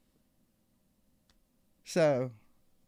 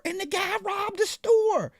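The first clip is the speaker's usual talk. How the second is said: 1 astonished reveal; 4 dB louder, slower than usual. Real, not acted. Punchline of the robbery reveal.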